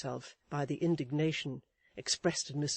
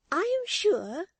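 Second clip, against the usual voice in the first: much higher, prim lady's voice; Missus Chick's voice; 8 dB louder than usual. A narrator's reading. Wife lecturing her husband, in a high prim voice. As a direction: preach a lesson, high and proper.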